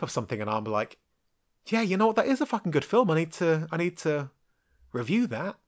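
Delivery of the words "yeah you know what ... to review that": higher-pitched, mulling enthusiasm; sudden realisation. Acted. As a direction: talk yourself into it, pitched up. Imagined reaction to seeing clip.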